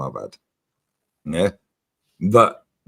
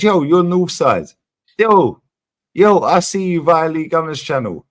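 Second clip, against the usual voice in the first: loud, high excited "yo, you're Northside"; retold hype greeting, louder and higher; excited fan greeting; 7 semitones higher, 7 dB louder than usual. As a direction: shout it like a starstruck fan spotting someone.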